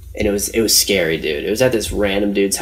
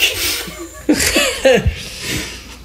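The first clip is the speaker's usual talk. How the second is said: airy laugh, much higher; high breathy laugh. Real, not acted. Laughing at his own party story, high airy laugh. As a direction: burst into a high wheezy laugh.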